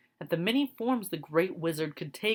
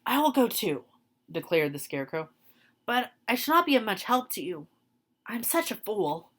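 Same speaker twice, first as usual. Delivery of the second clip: lightly higher, quicker Scarecrow voice; humble Scarecrow; 5 semitones higher than usual. Acted. Scarecrow volunteers but calls himself a fool, lightly higher. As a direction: offer help while running himself down.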